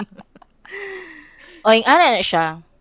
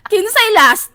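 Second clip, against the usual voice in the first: higher, louder question to the line; loud call-out; 9 semitones higher, 5 dB louder, slower than usual. Retold or imitated. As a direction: shout the question across the line.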